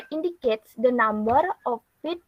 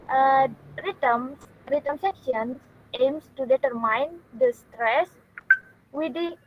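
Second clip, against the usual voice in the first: ordinary delivery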